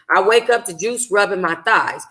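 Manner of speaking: usual speaking voice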